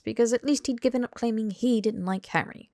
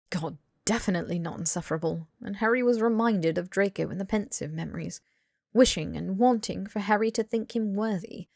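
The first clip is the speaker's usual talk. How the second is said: plain reading voice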